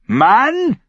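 very high, loud yell; piercing summons from a bossy wife; loud shout. A narrator's reading. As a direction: yell for your husband. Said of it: "schrie sie laut".

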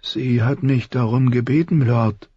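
defensive plea. A narrator's reading. Frightened boy defending himself to the prince, defensive plea. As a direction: plead your case without raising your voice. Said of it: "verteidigte sich Myka".

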